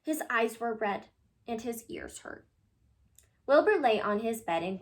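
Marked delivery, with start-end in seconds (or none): none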